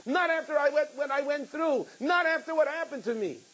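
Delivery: preaching high and intense; fervent preacher voice; impassioned preaching. Acted. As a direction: lift the sermon to a fervent pitch. Preaching at full force, high pitched.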